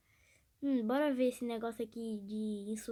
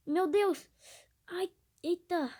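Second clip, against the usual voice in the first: susto; 6 semitones higher, 5 dB louder, slower than usual. Real, not acted. Queda no jogo.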